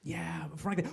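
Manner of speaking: hushed, conspiratorial whisper; whispered agreement. Real, not acted. Host agrees with the guest in a whisper. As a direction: agree under your breath.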